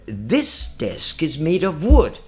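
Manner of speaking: slow, deliberate model sentence; careful correct sounds. Shown as a demonstration. Teacher modelling the right sounds, slow and careful. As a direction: stretch out each word distinctly. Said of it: "quando você tá com os sons certos".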